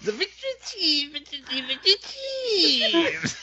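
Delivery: lightly voiced old witch; a hint of a witch, half laughing. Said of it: "Sounds like an old witch".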